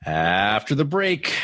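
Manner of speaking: slow drawn-out "After the break"; announcer tease. Shown as a demonstration. Host prompted to do his catchphrase, slow announcer tease. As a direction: stretch it out like a show host. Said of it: "go ahead".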